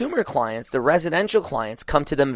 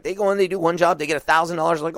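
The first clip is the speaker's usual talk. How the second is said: usual speaking voice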